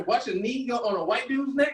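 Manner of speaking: usual speaking voice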